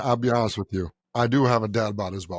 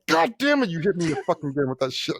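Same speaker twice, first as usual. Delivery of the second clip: mock stunned outburst; 7 semitones higher than usual. Retold reaction to the comeback.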